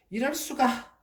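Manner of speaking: disbelief, it can't be; shocked gasp. Acted. Fox realizing the money is leaves, high shocked cry.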